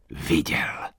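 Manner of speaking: breathless unvoiced whisper. A narrator's reading. Old man confirms his friend saw it, whispering. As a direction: breathe it out secretly. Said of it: "zašeptal stařec".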